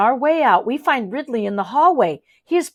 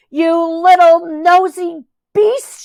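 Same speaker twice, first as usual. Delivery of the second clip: high, slow, loud accusing yell; furious shout; 7 semitones higher, 6 dB louder, slower than usual. A narrator's reading. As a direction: bellow it at the spy. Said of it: "she shouts".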